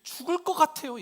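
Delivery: high, loud cry for help; panicked disciples' plea. Acted. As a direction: cry out to your master in desperation. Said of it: "제자들이 예수님께". (다급하게 높이 외치는 제자들)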